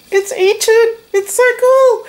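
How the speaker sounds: very high, loud excited squeal; real excitement. Real, not acted. Vlogger showing off a generous gift, excited squeal. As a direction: squeal it in sheer delight. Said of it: "Can't believe I'm so excited".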